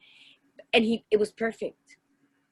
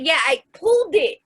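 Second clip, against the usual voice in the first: put-on toddler voice; 6 semitones higher, 4 dB louder than usual. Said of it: "He's like".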